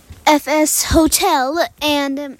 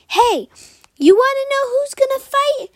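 high, much higher squeaky challenge; tough little muskrat; 12 semitones higher, 4 dB louder than usual. Acted. Muskrat taunts the unicorn, high squeaky challenge. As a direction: puff up like a tiny brawler.

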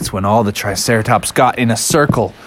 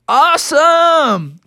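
high, drawn-out cheer; thrilled; much slower than usual. Acted. Bears offered a triceratops ride, thrilled. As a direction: stretch it out with delight.

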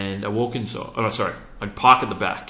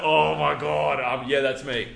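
laughing, higher, sheepish admission; embarrassed laugh; 6 semitones higher, 3 dB louder, slower than usual. Retold or imitated. Admitting to the salesman he's broke, embarrassed laughing. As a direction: laugh it off, caught out.

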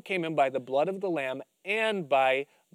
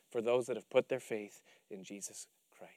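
whisper; 7 semitones lower, 10 dB quieter than usual. Real, not acted. Whispered close of a point.

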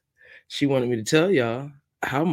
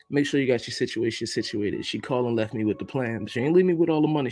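ordinary talk

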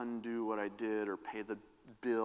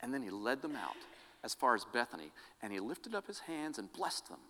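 rising, stirring Bible reading that builds to louder wonder; scripture reading; 3 semitones higher, 6 dB louder than usual. Acted. Pastor reading the ascension to the congregation, solemn then swelling. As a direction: proclaim the passage like it's happening now. Reads Luke's ascension passage aloud.

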